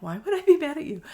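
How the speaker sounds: high and loud, honest disbelief; surprised question. Retold or imitated. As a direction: sound amazed she could think that. Retold reply to worried customer.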